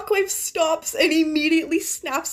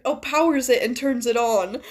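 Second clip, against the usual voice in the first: ordinary delivery, nothing marked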